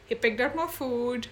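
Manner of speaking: usual speaking voice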